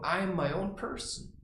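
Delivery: ordinary talk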